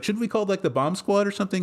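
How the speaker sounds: her voice jumps high, worried and pleading; a frightened teen begging to call for help; nervous daughter. Retold or imitated. Daughter pleads with dad about the bomb, high and anxious. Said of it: "And she's like".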